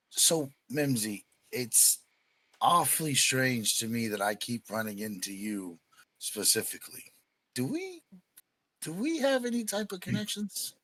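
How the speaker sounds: cold, measured questioning; stoic. Acted. Player's character confronts the NPC, quiet and stoic. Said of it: "demeanor ... completely change ... to almost stoic".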